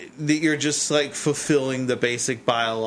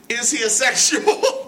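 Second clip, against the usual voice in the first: higher-pitched, teasing laugh through the words; laughing question; 9 semitones higher, 4 dB louder than usual. Real, not acted. Cohost teasing host over the word, laughing question. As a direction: ask it while cracking up.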